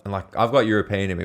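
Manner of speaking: ordinary talk, nothing marked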